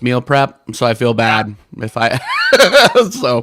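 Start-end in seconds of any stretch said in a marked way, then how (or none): 2.2-3.1 high loud burst of laughter. Real, not acted. Laughs at own remark.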